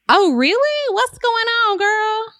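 put-on high, breezy gushing girl-talk; sassy realtor. Realtor bragging to her client, high and airy. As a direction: gush like a smug realtor.

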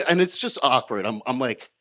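ordinary talk

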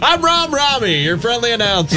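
high bright host voice; game show announcer. Pretend. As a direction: keep the announcer act going. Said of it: "I get to do my announcer voice".

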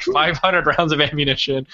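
ordinary talk

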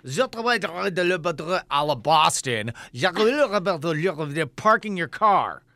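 slow mock French teacher; slow, mangled mock French accent; broken Canadian French. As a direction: imitate the bad French teacher. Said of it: "So he'd be like".

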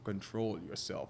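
slow, low, soothing coaching; soothing calm. Retold or imitated. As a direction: talk a nervous angler down slowly. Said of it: "I could see the panic from here".

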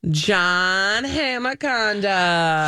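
stretched out very slowly, a playful big reveal; dramatic name drop. Shown as a demonstration. Host teasing her co-host with a slow name drop. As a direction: draw the name out like a teasing announcement. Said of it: "let me say one other name".

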